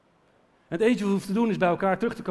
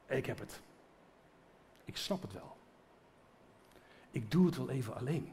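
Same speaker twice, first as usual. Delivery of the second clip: soft proud mutter; 6 semitones lower, 9 dB quieter than usual. Retold or imitated. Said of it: "ik heb gezegd".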